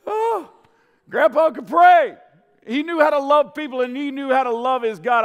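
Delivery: high, loud joyful praise; exultant. Real, not acted. Preacher to congregation, celebrating grandpa with loud delight. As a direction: lift it up with joy.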